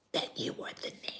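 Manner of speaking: fast hushed aside; half-whispered embarrassed admission. Retold or imitated. Stranger confessing her assumption, hushed whisper. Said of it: "she said".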